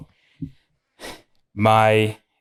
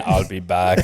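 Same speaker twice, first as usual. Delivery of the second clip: put-on laughing, light Austrian Terminator accent; mock Austrian Terminator accent through a laugh; 3 semitones lower than usual. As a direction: toss off the Terminator's Austrian catchphrase. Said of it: "That was a good accent".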